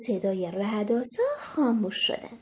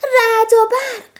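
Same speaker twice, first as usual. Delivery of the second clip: loud, higher, slow scared yell; frightened shout; 8 semitones higher, 10 dB louder, slower than usual. Acted. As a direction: cry out at the flash. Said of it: "فرانکلین داد زد".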